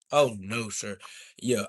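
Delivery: ordinary talk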